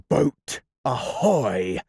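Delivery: slow pirate captain's hail; Hook hailing. Acted. Hook hailing his boat from the water. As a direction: draw out the call like Hook. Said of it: "in Hook's voice".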